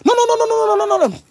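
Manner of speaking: high panicked pleading no. Retold or imitated. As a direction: beg him not to shoot, voice way up. Livreur paniqué face à l'arme. (non paniqué, très aigu)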